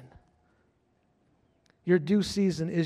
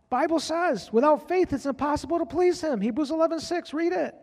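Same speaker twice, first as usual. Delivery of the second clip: high, loud preacher's exhortation; full-force preaching; 11 semitones higher, 6 dB louder than usual. Acted. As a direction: lift the room with a loud, high call to faith.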